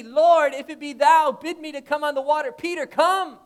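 a preacher's loud acted plea and answer; loud acted call. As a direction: call out the plea across the water. Acted Peter and Jesus exchange.

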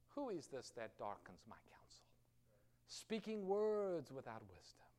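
hushed, slow, voiceless divine whisper; God speaking to Job. Acted. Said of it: "All God says to Job is".

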